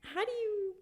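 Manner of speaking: high, loud, incredulous exasperation; laughing disbelief. Real, not acted. Owner tells friends about the cactus-eating dog, high incredulous exasperation.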